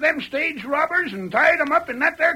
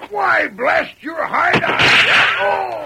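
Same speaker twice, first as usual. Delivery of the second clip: angry shout; 3 semitones higher, 5 dB louder, much slower than usual. Acted. Sheriff shot in the hand.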